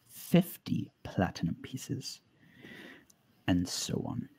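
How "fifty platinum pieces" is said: hushed. Acted. Shopkeeper quoting a secret price.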